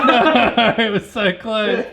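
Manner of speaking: laughing. Real, not acted. Part slipped, both laughing.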